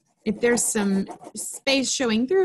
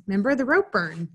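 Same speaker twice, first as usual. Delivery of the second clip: usual speaking voice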